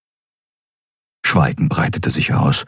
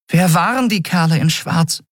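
high, bright little fairy voice, confused; a small flying creature asking, high-pitched; tiny fairy voice; 10 semitones higher than usual. Acted. Nelen asks Keru about the men, high fairy voice.

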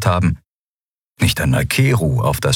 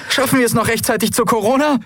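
high, fast, strained question; hurried high-pitched croak; faster than usual. A narrator's reading. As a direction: croak it out urgently after smoke. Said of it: "krächzte sie".

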